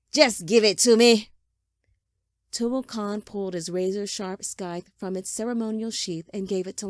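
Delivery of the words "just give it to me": very loud, impatient demand; angry insistence. A narrator's reading. Father cutting off son's protest, angry shouted demand. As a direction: override the protest with a shouted order.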